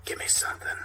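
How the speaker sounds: captain begging for a lead in a raspy whisper. Acted. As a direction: rasp it in a whisper. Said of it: "Raspy whisper".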